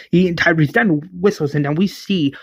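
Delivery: ordinary delivery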